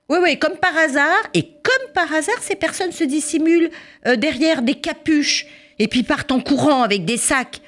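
a hint of sneering policeman; sarcastic policeman.

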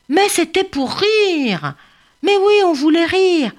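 high, halting excuse; sheepish mumbled excuse from the caught bully; stammering ostrich. Acted. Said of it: "bredouille la grosse autruche".

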